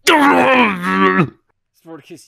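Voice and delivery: loud slow bellowed narration; yelling. Pretend. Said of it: "the top of his lungs".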